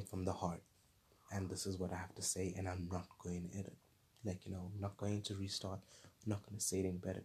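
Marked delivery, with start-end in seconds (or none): none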